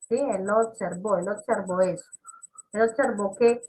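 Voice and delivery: normal talking voice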